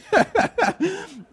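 high burst of laughter. Real, not acted. Pastor laughing at his own brush-off, high laugh.

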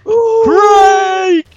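very high, loud drawn-out whoop; whooping cheer. Pretend. Host cheering Craig's return, excited high shout. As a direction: squeal the name like a fan.

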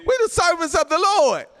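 high, ringing preacher's cry; fired-up pulpit shout; rousing declaration. Real, not acted. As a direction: proclaim it to rouse the congregation. Rousing the congregation.

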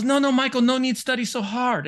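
loud, high imitation of a Chinese tiger mom's accent; Chinese mother's accent. Girlfriend's Chinese mom telling him off for studying, imitated accent. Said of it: "that was literally her accent".

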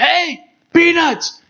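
distant, high, slow call from afar; far-off vendor call. Retold or imitated. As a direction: call it from across the stadium. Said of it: "way off".

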